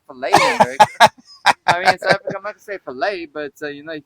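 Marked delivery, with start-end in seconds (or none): 0.1-0.3 "fillet" said "fuh-LAY", with a silent T; American pronunciation. Shown as a demonstration. 2.8-3.2 "fillet" said "fuh-LAY", with a silent T; American pronunciation. Shown as a demonstration. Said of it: "Because there's a T there".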